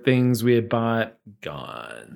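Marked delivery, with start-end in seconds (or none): 1.4-2.2 deadpan gone. Real, not acted. Dramatic reveal of stolen bag.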